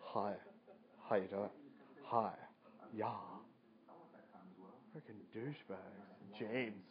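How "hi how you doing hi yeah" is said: ordinary delivery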